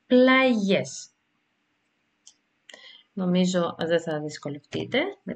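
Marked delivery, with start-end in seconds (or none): 0.1-1.1 πλαγιές higher, with a clear "yes" ending; pronunciation example. Shown as a demonstration. Teacher showing an example word, demonstrating "ye" in πλαγιές. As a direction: set πλαγιές apart and lean on the ye sound. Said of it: "δείτε το παράδειγμα".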